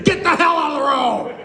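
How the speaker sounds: high, full-voiced holler; angry driver's yell. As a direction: bellow at a jaywalker from a truck. Truck driver yelling at jaywalker.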